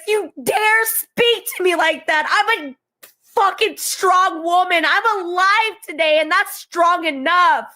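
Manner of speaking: normal talking voice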